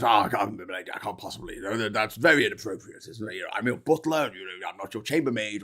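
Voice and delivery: mildly protesting butler in a joke; 困惑する執事. Acted. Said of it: "and, they, and he goes".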